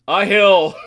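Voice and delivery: loud, high delighted yell; excited shout. Real, not acted. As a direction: shout it out in disbelief. Rolls a hill after all.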